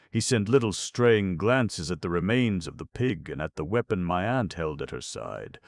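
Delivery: usual speaking voice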